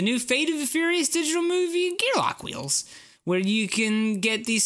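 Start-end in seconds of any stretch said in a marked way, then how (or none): 0.0-2.8 high excited announcer lift; hyped up. Acted. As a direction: sell it like a hype ad. Hyped pitch jump on product.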